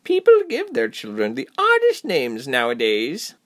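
high, swooping cheerful hostess voice; amused high-pitched woman; giggly woman. A narrator's reading. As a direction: remark it giddily to yourself. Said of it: "giggled to herself".

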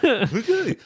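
laughing high and breathless; giggling at the co-host's noise. Real, not acted. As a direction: laugh through the words. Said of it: "embarrassed by that noise I just made".

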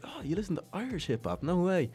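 hammed-up astonished boss; mock surprise. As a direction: act floored by the news. Adam surprised at the kid.

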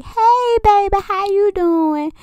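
high, slow sugary hello; mom's sweet greeting. Retold or imitated. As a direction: greet him sweetly like a doting mom. Mom greets boy at door.

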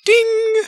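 high, loud ding sound; elevator ding. Acted. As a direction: sing out a bright ding. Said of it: "Goes the open door button".

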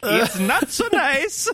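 slow, silly lightly voiced rocker; goofy voice. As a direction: ham up a dumb rocker voice. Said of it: "the voice he has picked for fucking, like, [name]".

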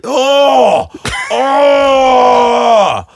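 a slow, loud whoop of delight; excited shout. Real, not acted. Delighted outburst at the joke.

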